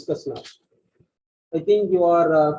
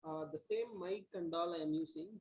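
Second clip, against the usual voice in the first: faint, hardly heard voice; speaking much too softly; barely audible; 21 dB quieter than usual. Real, not acted. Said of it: "No, I'm not able to hear you".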